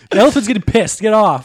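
loud, high shouted order; mock angry handler. Handler to child on elephant, mock shouting. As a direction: play a fed-up handler yelling.

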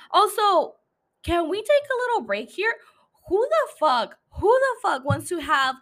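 high, loud disbelief; incredulous outburst. Real, not acted. Host interrupting her recap over the baby plan, incredulous outburst. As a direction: break off the recap in exasperated, high-pitched disbelief. Mock outrage at having a baby.

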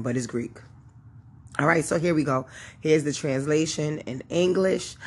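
normal talking voice

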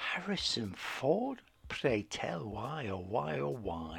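mock-dramatic disbelief. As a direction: draw it out in hammed-up bewilderment. Mock-dramatic questioning of a casting choice.

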